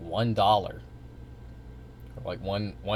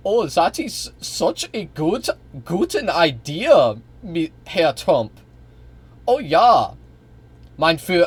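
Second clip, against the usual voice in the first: higher, louder, drawn-out hammed-up German accent; mock German accent; 6 semitones higher, 6 dB louder than usual. German chancellor agreeing with Trump, hammed-up German accent. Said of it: "your German accent sucks".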